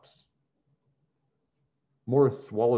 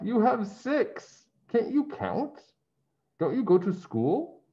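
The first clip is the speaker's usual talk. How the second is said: teasing man; 11 semitones higher than usual. Acted. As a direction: tease him like a know-it-all grown-up. Man teasing Morris about pennies.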